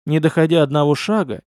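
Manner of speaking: plain reading voice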